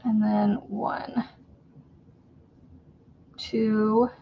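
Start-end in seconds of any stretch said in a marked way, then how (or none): 0.0-1.3 slow whispered count. Real, not acted. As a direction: breathe the count, slowly. Counting stitches softly.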